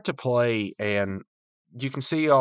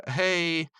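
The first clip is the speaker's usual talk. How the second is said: higher, laughing little greeting; imitated sheepish laugh; 8 semitones higher than usual. Mordecai caught out by Margaret, high sheepish laughing hey. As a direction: give a small put-on laugh into the word.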